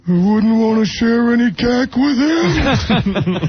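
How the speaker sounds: high, slow, laughing [name] impression; mock [name] voice. [name] joking about a [name] poster, high slow laughing.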